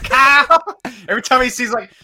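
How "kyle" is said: high, loud, stretched Kyle; cousin's Kyle call. Retold or imitated. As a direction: call Kyle out like a frustrated fan. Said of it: "my cousin always say".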